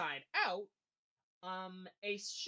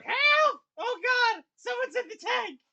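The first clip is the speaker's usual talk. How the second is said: high, loud cry for help; alarmed yell; shouting for help; 17 dB louder than usual. Acted. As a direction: scream it across the room. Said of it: "he just shouts out".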